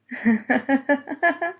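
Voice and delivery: a light laugh that lifts at the end; brief self-conscious chuckle; sheepish laugh. Acted. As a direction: laugh at your own joke, a bit embarrassed. Self-deprecating joke about common face.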